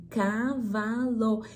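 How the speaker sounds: cavalo drawn out very slowly, syllable by syllable; sílaba por sílaba. Shown as a demonstration. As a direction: model CAVALO for a child, stretching ca-va-lo. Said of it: "filho, fala comigo". (cavalo silabado, bem arrastado)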